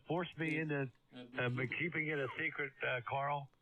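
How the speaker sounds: ordinary talk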